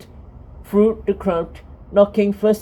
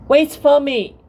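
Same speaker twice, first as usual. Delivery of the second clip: calling after them, raised voice; 6 semitones higher, 4 dB louder than usual. A narrator's reading. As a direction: call out to them. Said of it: "Dot called".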